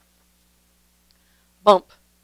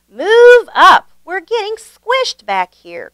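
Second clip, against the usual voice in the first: much higher, louder call; 10 semitones higher, 7 dB louder, slower than usual. A narrator's reading. The baker calling up to the front riders, loud and high. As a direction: call forward from the squashed back. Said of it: "called the baker".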